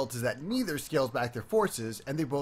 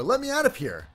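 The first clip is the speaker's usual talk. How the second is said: high desperate cry; panicked shout; 11 semitones higher, 6 dB louder than usual. Acted. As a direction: beg to be let out, loud. Panicked character plea.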